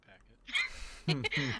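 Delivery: drawn-out loud grumble; slow, loud, exaggerated gripe; loud slow complaint. Real, not acted. Complains about salty dinner.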